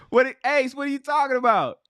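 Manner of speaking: high-pitched, tickled incredulity; amused disbelief. Real, not acted. Host asking Ace what he means, amused and high. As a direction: ask it high, fresh out of laughter.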